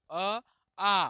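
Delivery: अ short, then आ drawn out, loud; short then long vowel. Shown as a demonstration. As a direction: contrast a quick अ with a long आ. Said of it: "तो आ बोलने आपका समय लगे". (अ छोटा, आ लंबा खींचकर)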